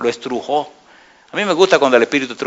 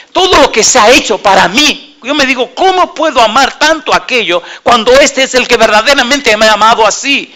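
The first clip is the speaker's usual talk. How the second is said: put-on higher, pleading voice of a crying boy; imitating a weeping student; 9 semitones higher, 4 dB louder than usual. Preacher retells the weeping student's words, higher and pleading. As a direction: replay his tearful confession, pitched up. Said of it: "llorando, me dice".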